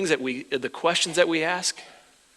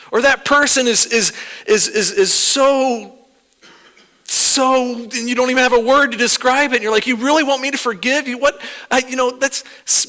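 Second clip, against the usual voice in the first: higher, louder, frustrated pleading with God; frustrated believer venting at God; exasperated complaint; 8 semitones higher, 5 dB louder than usual. Retold or imitated. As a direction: throw up your hands at God, loud and high.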